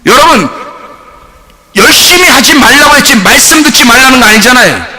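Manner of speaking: normal talking voice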